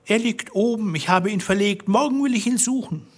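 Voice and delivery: high, hurried, fearful wife's voice; a trapped wife stalling with a high voice; wife caught out, pleading high and quick; frightened wife. A narrator's reading. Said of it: "Sie ward blutrot und antwortete".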